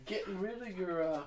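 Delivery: a hint of the theatrical hostess; grand hostess. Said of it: "expecting applause".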